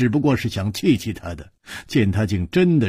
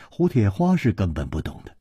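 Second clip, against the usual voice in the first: plain reading voice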